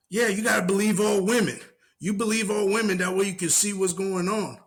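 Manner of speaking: high mock-preachy woman's voice; mocking her. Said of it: "she's like".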